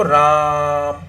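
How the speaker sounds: राम held very long on one steady pitch; stretched RAM. Shown as a demonstration. Teacher showing how the symbol is read, drawn out. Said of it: "यहाँ आप कैसे पढ़ेंगे इसको".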